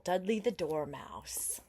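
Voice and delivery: ordinary delivery, nothing marked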